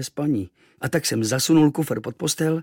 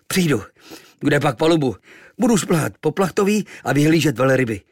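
hlasitě a výš, odhodlaně; bojovně; 4 semitones higher, 6 dB louder than usual. Acted. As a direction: vyhrkni to bojovně. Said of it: "řekl bojovně". (kluk bojovně odpovídá námořníkům)

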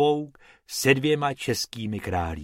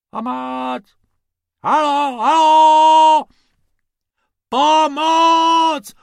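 much higher, much louder calling from far off; distant cries for help; 10 dB louder, much slower than usual. Acted. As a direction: shout for help across the desert. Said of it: "ozvalo se z pouště".

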